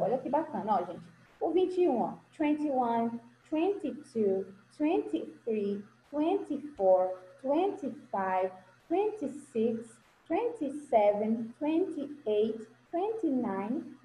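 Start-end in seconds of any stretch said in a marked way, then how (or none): 2.4-14.0 slow, careful English numbers, each one spaced out; slow model counting. Shown as a demonstration. Teacher models the numbers to her pupils, slow and careful. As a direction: count them slowly for the class to copy. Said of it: "Olha só como ele pronunciou".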